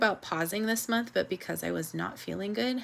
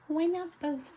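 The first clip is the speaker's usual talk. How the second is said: lightly mimicked little girl, higher; a playful nod to the famous clip; quoting a viral kid; 10 semitones higher than usual. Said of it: "You know that little girl who was, like, viral".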